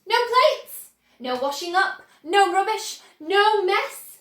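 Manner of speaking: excited. A narrator's reading. Wonka listing, building excitement.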